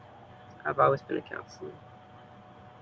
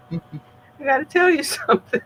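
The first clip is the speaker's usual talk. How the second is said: laughing confession; 13 semitones higher, 4 dB louder than usual. Retold or imitated. Retelling her own blurted confession.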